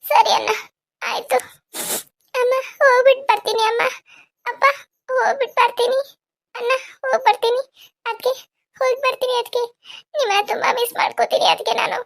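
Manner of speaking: high, weepy goodbyes; tearful farewell. Acted. Bride taking leave of each family member, tearfully. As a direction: say each goodbye through tears, in short broken phrases. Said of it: "ಯಾಕ್ ಅಳ್ತಿದಿ".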